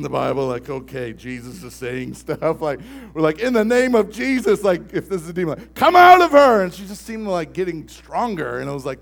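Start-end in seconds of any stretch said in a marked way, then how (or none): 3.4-4.6 higher, louder rebuke; commanding call. Retold or imitated. Youth pastor rebukes a demon in the girl, loud command. 5.7-6.6 much higher, loud shout; shouted command. Retold or imitated. Youth pastor shouts at the demon, forceful command.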